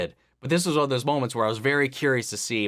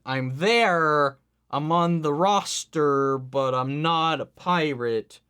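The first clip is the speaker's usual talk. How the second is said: higher, drawn-out mock protest; mock insistence; 4 semitones higher, 3 dB louder, slower than usual. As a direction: imitate a stubborn hair-splitter, stretching the key words. Mock-quotes a reluctant crewmate's insistence.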